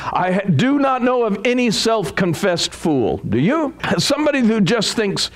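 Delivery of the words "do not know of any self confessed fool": normal talking voice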